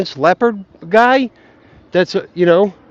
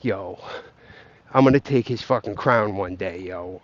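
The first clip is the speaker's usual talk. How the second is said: low, quiet scheming mutter, softer than his own voice; imitating Bitcoin Rants; 9 semitones lower, 6 dB quieter than usual. Bitcoin Rants, jealous of Jeff Booth, mocked in a low quiet scheming voice. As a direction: drop low and soft like a rival plotting to take the crown. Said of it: "Bitcoin Rants is all like".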